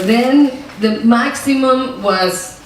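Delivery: ordinary talk, nothing marked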